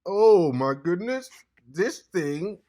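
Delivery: high, drawn-out amazed cry; amazed exclamation. Real, not acted. Vlogger marveling at this thing, drawn-out amazed exclamation. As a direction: stretch it out with swooping, loud wonder.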